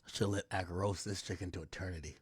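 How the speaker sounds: soft muttered [name] voice; a quiet hint of [name] scheming; lightly imitating [name]. Imagined [name] deciding, softly muttered. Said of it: "[name] probably right now, like".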